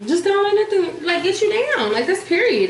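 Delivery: high, emphatic pep talk; fired-up encouragement to viewers; hyped up. Real, not acted. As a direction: pump the viewers up. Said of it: "motivational advice".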